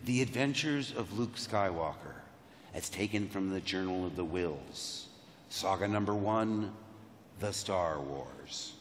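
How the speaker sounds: low, soft, portentous read-out; solemn title reading. Retold or imitated. Actor opening the script and reading its title, hushed and solemn. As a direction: intone it gravely like a title card.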